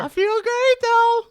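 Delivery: very high, loud, drawn-out whale call; whale impersonation. Acted. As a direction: bellow it slowly like a singing whale. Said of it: "that was a really good whale impersonation".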